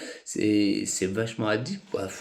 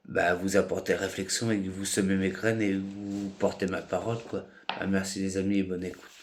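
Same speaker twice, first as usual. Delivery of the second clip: ordinary talk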